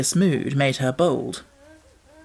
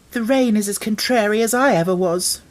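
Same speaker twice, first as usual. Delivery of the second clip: put-on higher, petulant girl's voice; sulky child; 6 semitones higher, 3 dB louder than usual. A narrator's reading. Mary sulking at the rain in bed, petulant. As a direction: sulk at the rain.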